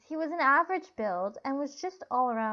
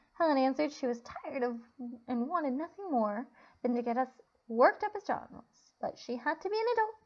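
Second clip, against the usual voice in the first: usual speaking voice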